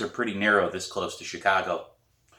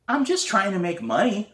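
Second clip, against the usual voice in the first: put-on young girl; 11 semitones higher than usual. Girl explains to neighbour.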